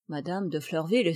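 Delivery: ordinary delivery, nothing marked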